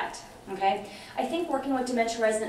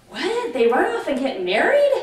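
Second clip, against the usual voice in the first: higher and louder, a shocked double take; startled husband; 6 semitones higher, 7 dB louder than usual. Retold or imitated. Husband mishears elopement, asks in loud shocked disbelief. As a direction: play the husband hearing elopement means marriage.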